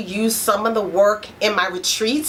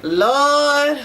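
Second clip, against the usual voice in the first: weary sigh; 3 semitones higher, 3 dB louder than usual. Pretend. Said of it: "You're actually saying".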